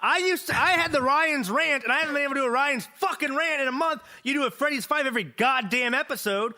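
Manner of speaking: high-pitched, exasperated tirade; indignant rant. Real, not acted. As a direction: rant in worked-up outrage. Ranting about his segment being displaced.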